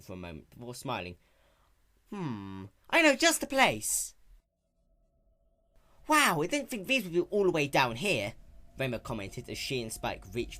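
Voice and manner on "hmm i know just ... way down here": high, loud sporty pony; cocky flier voice; tomboy pony voice. Acted. Rainbow voiced in story.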